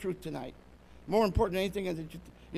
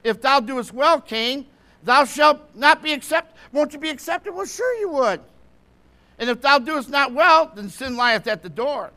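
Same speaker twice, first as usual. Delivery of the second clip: raised, loud scripture declamation; forceful preaching; 5 semitones higher, 8 dB louder than usual. Acted. Preacher voicing the Lord's warning to Cain, loud and forceful. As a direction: drive the verse home at full voice.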